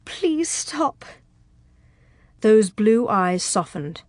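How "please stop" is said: high, soft pleading whimper; faint high whimper. A narrator's reading. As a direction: beg in a small, frightened voice. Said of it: "she whimpered".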